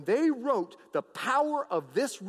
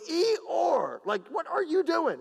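comic high-pitched donkey complaint; protesting donkey; 7 semitones higher, slower than usual. Retold or imitated. Donkey hit in the back by Balaam, mock indignant protest. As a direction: bray it, then object in the same raised pitch. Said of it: "And the donkey goes".

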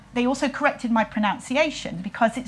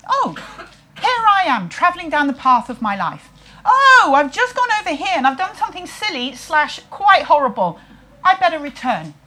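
a lightly voiced everyman, high and louder; musing to yourself as you stroll through life; a hint of a cheery wanderer who catches his own mistake; inner voice; 6 semitones higher, 6 dB louder than usual. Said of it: "the sort of bigger connotation".

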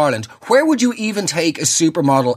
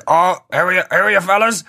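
higher-pitched, over-friendly weird dad; 4 semitones higher than usual. Acted. Dad walks in on sleepover, weirdly matey. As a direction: play the odd dad greeting the lads. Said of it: "real weird fucking dad who comes up".

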